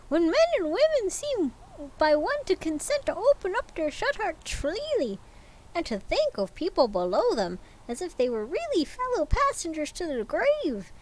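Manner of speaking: put-on high, fervent young man's voice; earnest nephew. Nephew defending Christmas to Scrooge, high and earnest. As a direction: lift the pitch and plead warmly.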